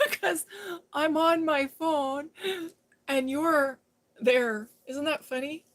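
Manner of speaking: amused excitement. Real, not acted. Telling Mikey the phone counts two people, giggling. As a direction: share a funny discovery, bubbling over. Amused discovery told to a viewer.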